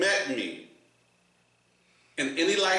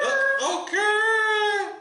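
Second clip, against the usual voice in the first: squeaky okay; 4 dB louder, much slower than usual. Pretend.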